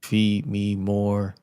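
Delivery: very slow robotic drone; toy robot voice. Pretend. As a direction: stretch each word like a machine. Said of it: "I am a robot".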